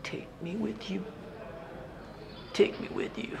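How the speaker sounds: breathless whisper, barely audible, pleading; whispered plea. Retold or imitated. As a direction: beg in a hushed whisper, afraid of being heard. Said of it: "and he whispers".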